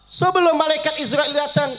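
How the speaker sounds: high, loud, building sermon cry; fervent high preacher build; preaching at full force. Acted. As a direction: lift the voice to a fervent preaching pitch.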